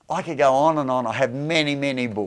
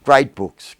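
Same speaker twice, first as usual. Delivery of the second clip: loud praise; 8 dB louder than usual. Real, not acted. Repeats praise of books.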